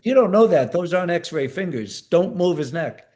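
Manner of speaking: high, loud scolding retort; retold angry shout. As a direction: shut the doctor down, loudly. Medic arguing with the doctor.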